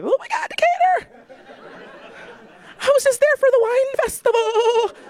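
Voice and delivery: high, bright-eyed gushing tourist voice with a laugh. Retold or imitated. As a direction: gush like a thrilled suburban wine lover.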